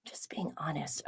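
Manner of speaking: soft unvoiced confession; whisper. Real, not acted. Vlogger admitting she doesn't care, whispered. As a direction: murmur it like a guilty secret. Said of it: "whispered aside".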